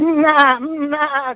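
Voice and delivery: high bleating goat cries. Retold or imitated. The herd answering the old goat, high bleats. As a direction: bleat back like the excited herd. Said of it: "The other goats would be like".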